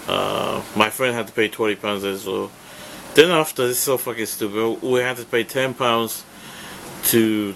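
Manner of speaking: usual speaking voice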